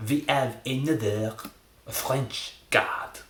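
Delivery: attempted French accent, drawn out. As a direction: announce it in a mock French accent. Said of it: "Maybe drop the French accent".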